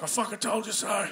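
high-pitched protesting toaster voice; complaining toaster. Retold or imitated. Toaster to its owner as smoke rises, high indignant whine.

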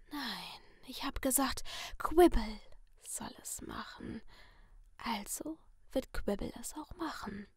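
sinister masked figure in a soft whisper; menacing whisper from behind a mask; creepy whisper. Acted. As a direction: purr the threat like a friendly killer. Letty insists in whisper.

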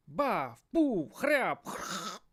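thumping crash sounds; comic crash onomatopoeia; crash noises. A narrator's reading. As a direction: spell out the tumble.

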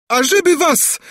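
very high screamed curse; sudden shriek. A narrator's reading. Princess suddenly cursing the men, shrieking. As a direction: burst out shrieking. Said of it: "wrzasnęła nagle".

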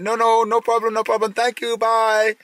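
high, nervous, hurried brush-off; mock panicky tourist voice; tourist's flustered reply. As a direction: imitate a tourist desperate to drive away, drawing out the goodbye. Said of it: "And he's like".

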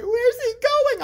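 put-on high, drawn-out protest of a kid; lightly hammed-up high kid's complaint; mock wailing kid. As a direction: whine it slowly like an upset child. Kid protesting as baby is taken away.

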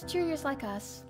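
normal talking voice

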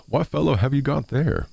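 high, suspicious question. Acted. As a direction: demand to know who he is. Miller questions stranger in straw.

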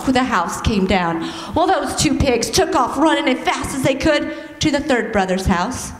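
normal talking voice

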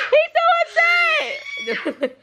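"He's so upset" çok yüksek perdeden, yavaşça uzatarak; slow, high drawn-out. Real, not acted. Laughing at upset dog.

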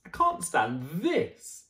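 slow, fed-up complaint; weary exasperation. Acted. Mister Bear fed up with the noise, slow weary complaint. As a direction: drag out each word in despair.

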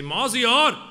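put-on loud, slow, high call in a thick Persian accent. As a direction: call the son across the park in a heavy Persian accent. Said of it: "in his thick Persian accent".

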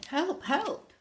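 high, loud shriek; shrieked cry for help. Acted. Tied-up pirate crying for rescue, shrieked. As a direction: shriek out in alarm. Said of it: "shrieked a pirate".

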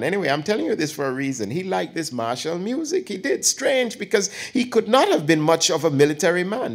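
put-on chatty elderly aunt teasing her nephew; old aunt voice. Aunt teasing her nephew before his girlfriend, lightly voiced old woman.

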